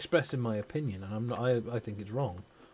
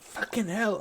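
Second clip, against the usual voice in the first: much higher mock child voice; child's swear; 10 semitones higher, slower than usual. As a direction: imitate a toddler swearing casually. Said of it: "And she went".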